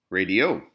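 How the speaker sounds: "radio" said ra-di-O, loud on the end; stress on last syllable. Shown as a demonstration. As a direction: punch the final O of "radio". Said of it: "We don't pronounce the last two options".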